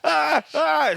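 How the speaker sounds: short high yelps; hammed-up cries of being stabbed; mock stabbing screams. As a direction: yelp with each pretend stab.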